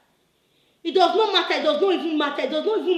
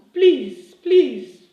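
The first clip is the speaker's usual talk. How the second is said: louder, emphatic protest; exasperated plea; 7 dB louder than usual. Real, not acted. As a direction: cut in loudly with an insistent plea. Interrupts own rant to protest.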